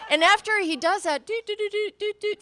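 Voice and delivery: ordinary talk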